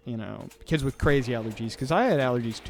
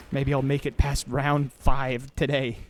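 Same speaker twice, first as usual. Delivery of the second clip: ordinary talk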